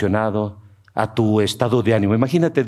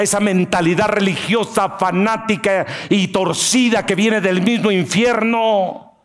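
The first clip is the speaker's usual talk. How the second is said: higher, slow fervent preaching; full-force preaching; 10 semitones higher, slower than usual. Real, not acted. Preacher rebukes the congregation, higher and slow.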